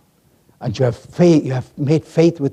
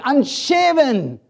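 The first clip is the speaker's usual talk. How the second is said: ordinary talk, nothing marked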